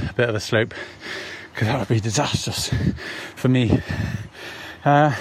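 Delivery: short phrases with breathing pauses after a hard run. Real, not acted. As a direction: catch your breath between each phrase. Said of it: "see just how out of breath I am".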